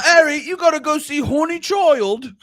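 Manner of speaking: high, loony British film voice; hammed-up advice to Harry; mock British character. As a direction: send up a British wizard, pitched high.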